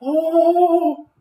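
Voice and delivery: very high, loud panicked wail; screamed. A narrator's reading. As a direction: shriek as if giddy with panic. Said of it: "Missus Twit screamed".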